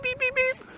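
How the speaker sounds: high squeaky bleep sounds; playful censor bleeps; mock bleep. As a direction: beep it out like a censor tone. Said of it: "Just bleep it over".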